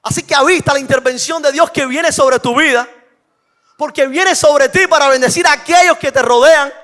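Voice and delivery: loud, high sermon delivery; fiery preaching. Acted. Preacher challenging the congregation, loud and fervent. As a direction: press the point home to the listeners. Climactic proclamation.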